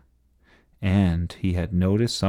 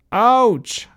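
high, loud yelp of pain; crying out; 14 semitones higher, 5 dB louder than usual. A narrator's reading. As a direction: yelp as if bumped. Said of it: "cried Ernest".

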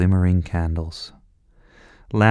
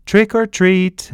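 loud, high, drawn-out chorus shout; 12 semitones higher, 6 dB louder, slower than usual. Acted. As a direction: call it out together at the door. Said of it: "shouted everyone".

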